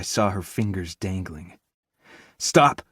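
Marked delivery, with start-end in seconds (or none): 2.4-2.8 shout. Acted. Rescuers lifting lockers.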